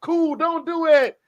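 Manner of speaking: high, slow pleading imitation; mock inner plea. Host's eyes begging him not to bet, high slow plea. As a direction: silently beg him not to, drawn out high.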